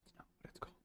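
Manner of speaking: breathy whisper confessing; conspiratorial whisper; whispered aside. Acted. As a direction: murmur it as a secret.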